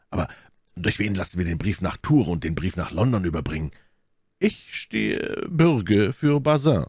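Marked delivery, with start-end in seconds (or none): 4.4-6.8 high, slow character voice; Aramis vouching. A narrator's reading. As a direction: pledge it slowly in a raised voice. Aramis solemnly vouches for his servant.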